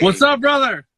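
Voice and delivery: big, booming happy greeting; excited greeting. Real, not acted. Hailing the host on joining.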